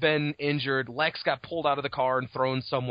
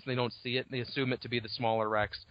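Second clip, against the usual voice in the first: normal talking voice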